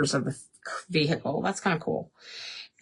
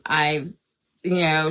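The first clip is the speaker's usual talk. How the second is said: ordinary talk, nothing marked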